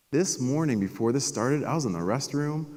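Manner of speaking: ordinary delivery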